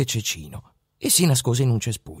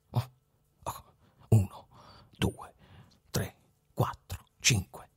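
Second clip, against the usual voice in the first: whispered count, slow; whispering; 2 semitones lower, 9 dB quieter than usual. A narrator's reading. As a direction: count the money in a whisper so no one hears. Lone robber counting money secretly. (conta sussurrando)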